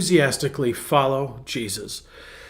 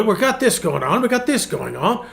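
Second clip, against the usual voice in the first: high-pitched British imitation, building louder; mock British accent; 8 semitones higher, 4 dB louder than usual. Pastor imitating a renovator cracking on, mock British accent. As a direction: play the keen Brit in a put-on accent. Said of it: "he, he says".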